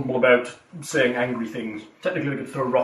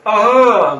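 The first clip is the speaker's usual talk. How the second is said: yelp; 7 semitones higher, 9 dB louder than usual. Retold or imitated. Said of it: "Phelan just went".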